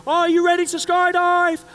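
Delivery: high, loud shout over the engines; instructor shouting. Retold or imitated. Instructor yells to the first-timer over the engines, loud. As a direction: bellow the question over the plane noise. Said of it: "he says".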